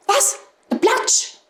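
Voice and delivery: very high frightened question; startled hedgehogs. A narrator's reading. Hedgehogs hearing of the Platsch, high startled voice. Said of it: "ganz verschrocken gesagt".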